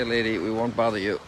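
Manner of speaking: ordinary talk, nothing marked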